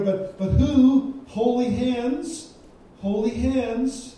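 slow, high and loud, stretching each word; emphatic preaching. Acted. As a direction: lean on it and let it ring. Preaching who may ascend the hill.

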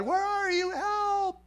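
very high, strained plea; crying out for help. Acted. Son of Man calling out to God, high desperate cry. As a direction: call up to heaven in a high, desperate voice. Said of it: "crying out to God".

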